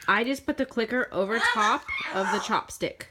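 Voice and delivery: normal talking voice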